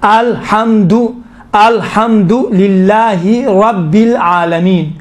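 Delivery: slow अलहम्दु with heavy रब्बिल; correct recitation. Shown as a demonstration. Teacher reciting the correct form for students, slowly. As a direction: recite अल्हम्दुलिल्लाहि slowly, ra full and thick. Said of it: "अलहम्दु नहीं है".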